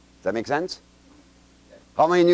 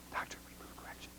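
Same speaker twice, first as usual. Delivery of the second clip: whisper; 27 dB quieter, faster than usual. Shown as a demonstration. Class repeats the drill softly.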